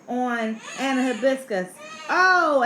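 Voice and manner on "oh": high, loud oh; surprised cry. A narrator's reading. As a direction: cry out as a wave hits. Child jumped on by waves.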